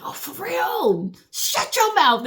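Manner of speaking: high, drawn-out mock amazement; fake surprise. Pretending a known secret is news, high mock gasp. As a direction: overplay being shocked. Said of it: "you gotta act like".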